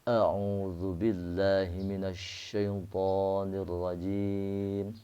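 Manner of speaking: "rajim" with the ra trilled three times, slow; slow Quranic recitation, "minasyaitonir rajim" with a trilled ra. Shown as a demonstration. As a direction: chant slowly, rolling the ra of "rajim". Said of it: "irti'ad ra' sulisan".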